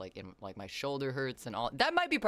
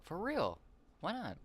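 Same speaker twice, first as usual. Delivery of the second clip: high whine; 7 semitones higher than usual. Real, not acted.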